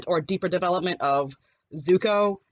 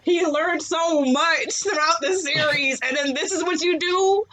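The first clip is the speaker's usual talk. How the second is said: voz aguda de incredulidad total; incredulous protest; 9 semitones higher than usual. Retold or imitated. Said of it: "I'm like".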